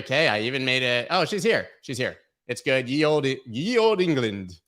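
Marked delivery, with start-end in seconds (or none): 2.9-4.6 a slow, hammy mock olde English accent; lightly voiced British accent; bad British accent. A hint of British accent mocking a tweet for viewers. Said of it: "That was the worst accent".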